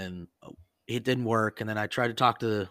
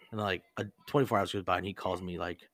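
plain reading voice

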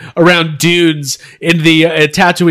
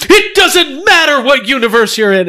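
higher-pitched, hyped action-star catchphrase; The Rock; 7 semitones higher than usual. Acted. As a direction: announce it like a wrestling star. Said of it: "The Rock shows up and goes".

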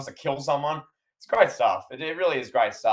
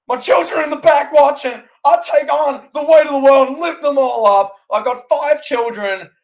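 full-voiced yelling, pitched way up; Roman Reigns; 12 semitones higher, 10 dB louder than usual. A fan re-enacts the champion's rant at Jey Uso, yelling. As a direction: yell it as a furious, overburdened family head. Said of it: "When Roman Reigns is yelling at Jey Uso".

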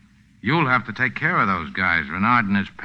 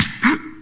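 sharp frightened gasp. Acted. Renard about to be beaten.

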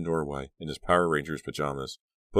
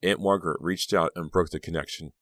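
plain reading voice

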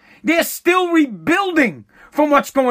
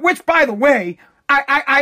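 ordinary delivery

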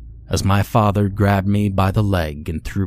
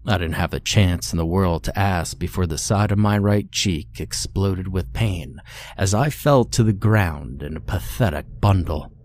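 ordinary delivery, nothing marked

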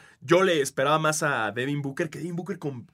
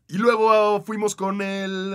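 drawn-out lead-in; 7 semitones higher, 7 dB louder, much slower than usual. Real, not acted. Drawn-out hyped lead-in to contest.

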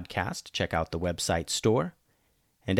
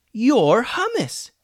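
surprised yell; 14 semitones higher, 7 dB louder, much slower than usual. Acted. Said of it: "yelled Julian".

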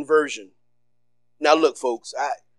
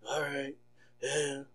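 much softer, a listless mock "all right, yeah"; mock bored churchgoer; 5 semitones lower, 19 dB quieter than usual. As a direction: play the unimpressed worshipper, barely bothered. Mocking a half-hearted churchgoer.

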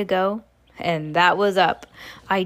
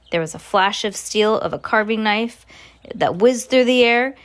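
ordinary delivery

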